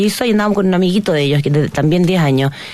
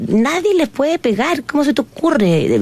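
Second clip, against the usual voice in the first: high, slow, outraged protest; a touch of retold anger, pitched up; indignant retort; 8 semitones higher, slower than usual. Mother confronting the supermarket staff, mildly angry. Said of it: "Me enojé, me ofusqué mucho".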